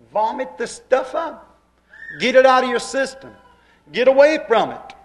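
high, loud commanding cry; preaching command. Acted. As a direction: drive them out of the mire. Preacher commands sinners, preaching force.

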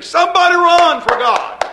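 high, loud revival call; evangelist shouting. Retold or imitated. Evangelist urging the congregation to run, shouting. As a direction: whip up the crowd with a holler. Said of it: "I've seen an evangelist get up and he say".

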